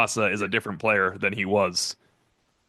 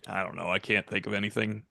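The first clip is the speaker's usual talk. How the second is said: Jaylen Sneed; 2 semitones lower, 6 dB quieter than usual. Retold or imitated. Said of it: "try to do my best imitation of it".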